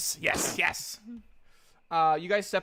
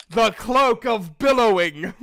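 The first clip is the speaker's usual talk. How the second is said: louder, dramatic scene-setting; grand narration; 6 semitones higher, 8 dB louder than usual. Acted. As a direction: describe the cloak with awe and grandeur. GM's grand description of a magic item.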